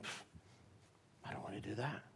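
dismissive refusal. Retold or imitated. Jonah brushing off God's call, softly scoffing. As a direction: brush God off with a soft scoff.

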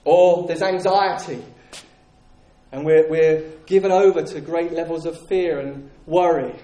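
ordinary delivery

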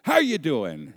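booming, high-pitched hearty greeting; jovial dad. Retold or imitated. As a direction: imitate a dad delighted to hear his kid. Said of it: "his jovial".

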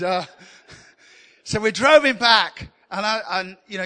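ordinary talk, nothing marked

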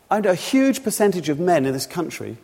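usual speaking voice

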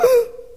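sharp high gasp of alarm; panicked gasp. Retold or imitated. Rose hearing what dad told relatives, a panicked gasp. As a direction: catch your breath in sudden panic. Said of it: "my first reaction was to panic a little bit".

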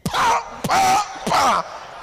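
high, loud "pa" smacks; three punchy shouted slap noises; imitated slaps. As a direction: mimic a parent's smacks with the voice. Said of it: "Old African parent day".

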